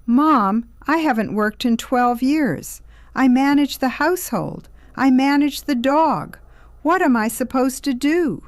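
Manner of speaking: high, loud, indignant wail; aggrieved, panicky daughter; distraught protest. A narrator's reading. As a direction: plead helplessly with mom, pitched right up. Appalled daughter protesting to mother.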